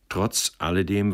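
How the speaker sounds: ordinary delivery, nothing marked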